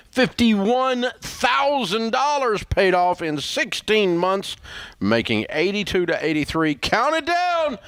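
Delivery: high, slow, building hype; hyped announcer. Real, not acted. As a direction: pump up the crowd. Host announcing debt-free scream.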